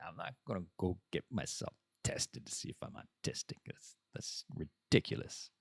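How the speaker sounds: very low, hushed grumble; muttered retort. Retold or imitated. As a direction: drop to a low, soft mutter. His muttered reply to her.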